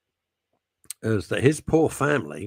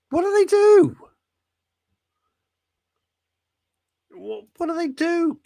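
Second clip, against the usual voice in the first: much higher, silly voice for the listener; mock caller voice; 5 dB louder than usual. Host pretends a listener talks squeakily, asking what insects do. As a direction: ham up the listener asking, pitched way up. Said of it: "He doesn't talk like that".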